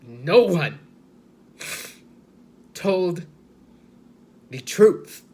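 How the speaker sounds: emphatic outburst, broken by long pauses; loud emphasis. Real, not acted. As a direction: punch out each phrase loud and high. Emphatic declaration that truth was hidden.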